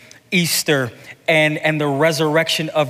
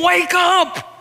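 full-force preaching. Real, not acted. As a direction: preach it out to the congregation.

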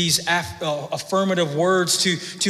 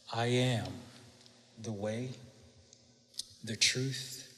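hushed quote; 7 semitones lower, 16 dB quieter than usual. Acted. Pastor quotes Jesus again, hushed.

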